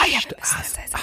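fast unvoiced whisper; hurried whisper. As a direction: rush it out hushed. (hastiges Flüstern)

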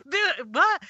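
sudden high-pitched disbelieving what; stunned what. Retold or imitated. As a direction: squeak it out in shock. Shocked reaction to secret firing.

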